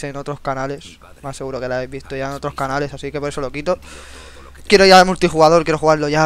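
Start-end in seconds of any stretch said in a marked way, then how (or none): 4.7-6.2 eager. Real, not acted. Eager to start playing.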